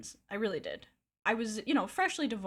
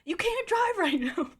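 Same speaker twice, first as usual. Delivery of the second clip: high pleading yell through the car window; imitating him pressed against the car window; mock frantic plea; 10 semitones higher, 5 dB louder than usual. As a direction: beg her not to drive, high and loud. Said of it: "And he's like".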